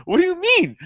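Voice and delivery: laughing disbelief. Real, not acted. Commentator laughing at news network's denial, high exasperated disbelief. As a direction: pitch shooting up in laughing disbelief.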